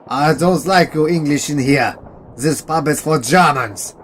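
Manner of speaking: put-on hostile German soldier in a German accent; the German drunk telling the English to leave, German accent. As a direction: warn the strangers off in a thick German accent.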